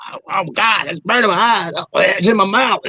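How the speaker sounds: high-pitched, hammed-up wail; mock agony. Blinded by the gas, hammed-up panicked wail. Acts out being hit by gas.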